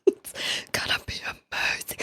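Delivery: soft breathy zombie whisper. Retold or imitated. Echoing the zombie alert clip.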